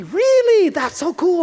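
high, thrilled really; amazed delight. Retold or imitated. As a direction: gush with wonder. Listener amazed by the boast.

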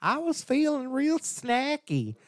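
put-on high, slow Southern child voice; imitating a Southern kid asking for Cheetos; cute country kid. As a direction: drawl it like a little country kid. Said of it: "real cute country accent".

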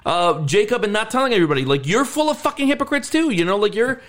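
high, fast heated rant; heated retort. Retold or imitated. Daughter turning on her father, high heated rant. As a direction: fire the hypocrisy back at him.

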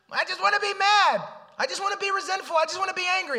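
very high, loud whining pouty voice; a high, loud cartoon sulk; whiny inner grumbler. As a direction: whine like a sulky child who wants to stay mad. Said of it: "What's the inner grumbling voice gonna say?".

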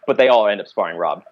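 ordinary delivery

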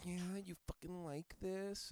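mock seductive. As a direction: purr it like a put-on seductress. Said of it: "broke character".

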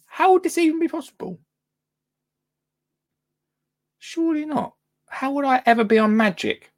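surprised. Real, not acted. Disbelief at the news.

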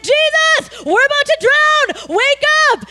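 panicked disciples. Retold or imitated. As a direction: cry out in alarm to wake him.

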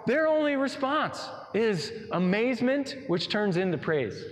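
normal talking voice

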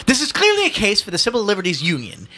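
high, loud indignant orator; pompous protest. A narrator's reading. Outraged son observing to his mother, pompous. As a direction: declare it as a grand grievance.

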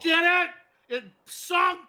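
loud, high exasperated yell; angry guy. Acted. Angry guy explaining his ship sank, exasperated yell. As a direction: hammer the point home, yelling. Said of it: "you're the angry guy who didn't make the".

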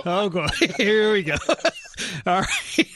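real laughter bursting between the words; laughing. Real, not acted. Host losing it at co-host's joke, laughing hard. Breaks up over colleague's joke.

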